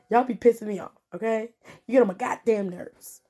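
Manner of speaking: ordinary talk, nothing marked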